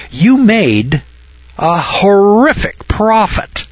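high, slow, drawn-out mock shock on horrific; mock horror. Teacher mocking the profit as scandalous, high mock shock. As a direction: stretch horrific up high in pretend outrage.

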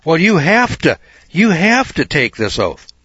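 high, loud, worked-up judge; an exasperated judge insisting; high and loud, slowing on the oath; excited judge. Retold or imitated. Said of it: "he got kind of excited".

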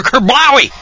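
loud, high-pitched comic-book "kerblowy"; explosive storyteller's sound effect; imitated chop impact. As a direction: boom it out high as the blade comes down. Imitates the scimitar coming down.

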